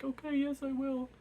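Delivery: a cowed little yes after the pain; meek surrender. Retold or imitated. Tortured king giving in to the wizard, high meek squeak. Jezal gives in under pain.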